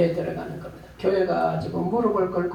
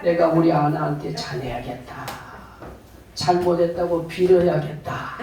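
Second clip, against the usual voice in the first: ordinary talk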